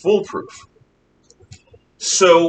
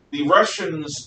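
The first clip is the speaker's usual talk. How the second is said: ordinary delivery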